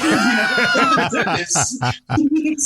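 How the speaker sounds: high laugh through slow words. Real, not acted. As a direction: laugh it out slowly. Laughing at his own story.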